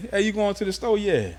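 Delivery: light imitation of a New Orleans accent. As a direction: mimic a New Orleans neighbor, every line lifted like a question. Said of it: "people from New Orleans talk, like, with a question".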